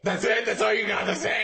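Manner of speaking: mock indignation with a laugh; mock outrage. Friend mocks the pilot.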